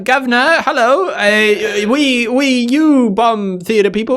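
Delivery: mock villain speech. Cohost parodying the saboteur's speech in a silly high voice. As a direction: babble a parody villain monologue in a cartoonish voice. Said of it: "that tropey scene".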